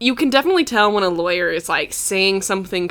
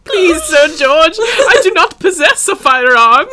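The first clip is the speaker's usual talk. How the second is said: high, loud, drawn-out comic begging; mock pleading; 8 semitones higher, 6 dB louder, slower than usual. Unarmed person begging Sir George, mock pleading. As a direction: plead slowly in hammed-up distress.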